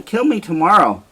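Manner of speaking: a wife bargains with her husband for her life, frantic; frantic bargaining. Acted. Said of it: "Desdemona proposes an alternative plan".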